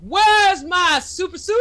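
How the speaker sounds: high, loud movie-quote holler; playfully bellowed catchphrase; catchphrase yell. Acted. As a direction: holler it like the famous line.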